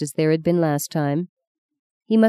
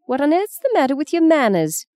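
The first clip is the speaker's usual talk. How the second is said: high-pitched, indignant reprimand; grandmother's sharp, high scolding; scolding demand; 10 semitones higher than usual. A narrator's reading. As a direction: demand better manners from a rude child. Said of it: "demanded Fee".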